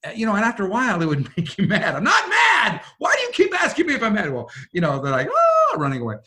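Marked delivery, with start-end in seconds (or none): 1.9-4.3 high, loud exasperated shout; irritated outburst. Retold or imitated. Retold irritation with his kids. 5.3-5.8 very high, loud mock squeal of fright; kids' startled yelp. Kids running away scared.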